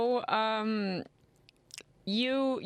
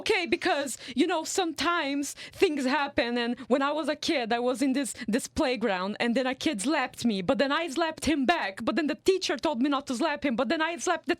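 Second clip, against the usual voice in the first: higher-pitched runaway retelling of a rant; rambling tangent; 5 semitones higher than usual. Retold or imitated. Imitating a preacher going into a therapy tangent, rambling. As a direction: imitate someone spiralling off on a tangent. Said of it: "he was just going off on a tangent".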